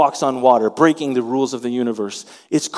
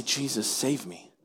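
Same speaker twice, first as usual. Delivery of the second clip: soft plea; 11 dB quieter than usual. Acted. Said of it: "We say".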